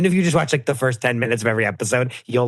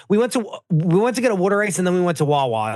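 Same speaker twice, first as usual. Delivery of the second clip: higher, quick mock Philly accent; Delco Philadelphia accent; 6 semitones higher, faster than usual. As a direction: rattle it off in exaggerated Delco accent. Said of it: "accent work as possible up front".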